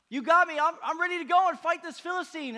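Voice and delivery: high, loud shout, eager to be sent; fired-up shout. Acted. David volunteering to King Saul to fight, fired-up shout.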